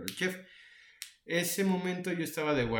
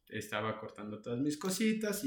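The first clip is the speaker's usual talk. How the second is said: usual speaking voice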